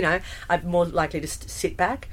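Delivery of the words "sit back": usual speaking voice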